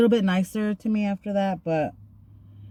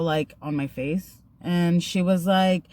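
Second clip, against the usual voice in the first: normal talking voice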